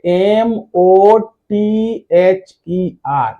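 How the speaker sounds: each letter said slowly and apart; spelled out letter by letter. Shown as a demonstration. Teacher spelling a word for students, slow and deliberate. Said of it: "एक word देखिए".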